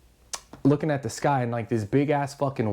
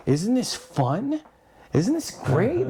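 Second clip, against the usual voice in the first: put-on high, slow, drawn-out kid's question; eerily cheerful kid; 8 semitones higher, much slower than usual. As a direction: imitate the kid, lilting up on each question. Kid voiced in a story.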